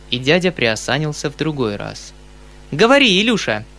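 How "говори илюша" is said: high, brisk order to little brother; commanding child, pitched up; girl's command. A narrator's reading. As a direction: command him like a bossy sister. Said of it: "скомандовала Сашенька".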